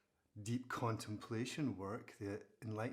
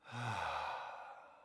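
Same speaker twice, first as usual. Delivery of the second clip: long, audible exhale. Shown as a demonstration. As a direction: breathe out fully in a long sigh. Models a deep breath.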